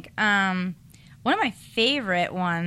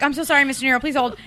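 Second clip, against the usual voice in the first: flustered, higher and louder; nervous apology, higher pitched; 6 semitones higher, 4 dB louder, faster than usual. Retold or imitated. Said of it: "So I get all nervous".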